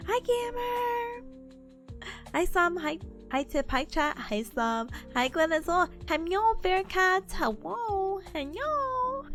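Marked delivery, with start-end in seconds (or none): 0.1-1.2 high, slow, cutesy voice; cute anime streamer. Acted. Repeats greeting in a put-on voice. 6.1-9.3 cute anime streamer. Acted. Cutesy greeting, repeated.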